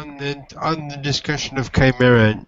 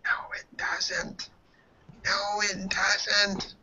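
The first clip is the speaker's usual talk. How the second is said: unvoiced whisper, slow repeat; whispered behind hand; 7 semitones higher, 9 dB quieter, slower than usual. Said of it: "mouths behind his hand".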